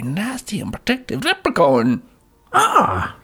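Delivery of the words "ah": high loud trailer shriek; mock scream. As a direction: shriek like a horror trailer victim. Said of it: "did you like what I did there".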